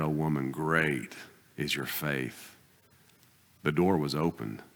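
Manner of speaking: ordinary talk, nothing marked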